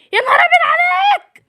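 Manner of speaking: high shocked outcry; shocked shout. Acted. Mother shouts at the dad over the wrecked TV. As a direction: cry out in shock.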